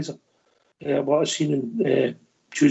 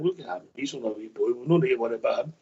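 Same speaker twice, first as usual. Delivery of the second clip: quick, quieter, brushing-off tone; dismissive mock voice; 3 semitones lower, 5 dB quieter, faster than usual. Barcelona veterans shrugging off young Messi, quick quiet dismissive mock. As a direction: toss it off like a smug senior pro.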